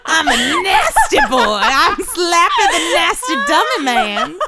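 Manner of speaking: high, slow, laughing creepy dummy; Jinx voice. Pretend. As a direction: ham up a nasty dummy, slowly. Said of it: "that's your Jinx voice".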